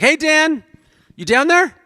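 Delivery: wife calling out to husband downstairs; high, bright call from another floor. Retold or imitated. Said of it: "she's like".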